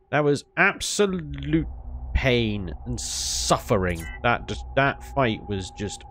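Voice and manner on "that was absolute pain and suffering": mock-tragic slow complaint; slow dramatic lament. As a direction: drag it out, slow and weary.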